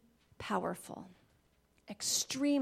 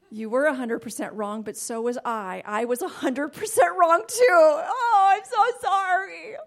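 louder, rising heartfelt apology; moved apology; 5 semitones higher, 10 dB louder than usual. Retold or imitated. Wife answers husband, swelling heartfelt apology. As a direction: pour out sorrow, swelling up. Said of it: "A conviction falls all over me".

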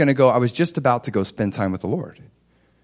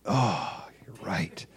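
whispered, sheepish concession; chastened admission; 5 semitones lower, 11 dB quieter, much slower than usual. Retold or imitated. Husband conceding to the Holy Spirit, chastened whisper. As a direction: breathe it out low, conceding the point. Convicted by God, gives in.

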